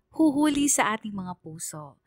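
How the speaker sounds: ordinary talk, nothing marked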